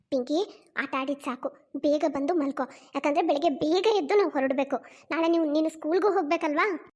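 put-on low-pitched, gentle mother telling her child to come to bed. Voices mother to daughter.

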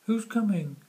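high, loud little chick voice. Acted. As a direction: pipe it up like a tiny eager chick. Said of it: "asks the little chick".

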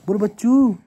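loud, high call to the little one. Real, not acted. Calling on the toddler to speak: loud high call. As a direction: call out bachchu loudly.

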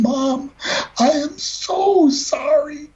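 slow, high, breaking plea; real tears in a slow, pleading voice; tearful apology. Real, not acted. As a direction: let it crack as you say sorry. Apologising to his late mother.

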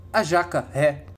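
usual speaking voice

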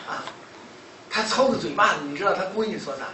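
ordinary talk

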